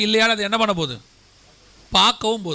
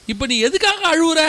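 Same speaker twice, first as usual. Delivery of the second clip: higher, exasperated husband asking; 6 semitones higher than usual. Retold or imitated. Husband at the end of his rope asks his crying wife why, exasperated. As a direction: ask in mock frustration why she cries. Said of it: "அப்படின்னு சொன்னா".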